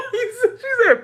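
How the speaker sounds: high squealing laughter; real laughing. Real, not acted. Teller cracking up at what mom said, real laughing. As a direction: crack up, words squeezed out through laughter.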